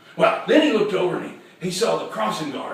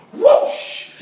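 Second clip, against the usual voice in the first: loud, high airy whoosh; explosive swishing sound effect; imitated whoosh; 9 dB louder than usual. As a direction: voice a big swing cutting the air. Imitating the dog's swing.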